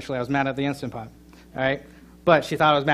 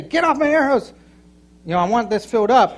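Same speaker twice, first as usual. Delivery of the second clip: much higher, mock demanding voice; pretend spouse snapping; 7 semitones higher, 5 dB louder than usual. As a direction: shove them off, playfully indignant. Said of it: "pushing each other off".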